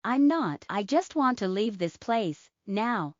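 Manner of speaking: ordinary delivery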